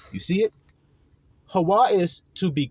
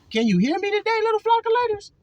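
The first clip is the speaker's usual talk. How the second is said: much higher, sing-song call to the congregation; much faster than usual. Real, not acted. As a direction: lift the voice way up and sing it out to the flock. Preacher calls out to his flock.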